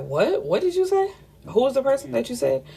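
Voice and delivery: retold startled question, pitched high; mock disbelief, voice leaping up at the end; taken aback. Man thrown by a familiar name, taken aback.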